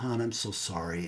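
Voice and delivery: low, soft retold apology; sheepish apology. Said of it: "I had to apologize".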